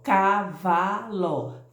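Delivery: CAVALO drawn out slowly, ca-va-lo; teacher sounding out CAVALO syllable by syllable. Shown as a demonstration. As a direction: break CAVALO into three slow, even syllables. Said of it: "Fala silabando, fala devagar".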